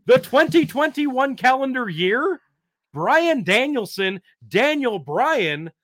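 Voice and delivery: loud, high, fired-up promo; wrestling promo. Acted. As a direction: cut it like a heel on the mic. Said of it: "that's how you cut a promo".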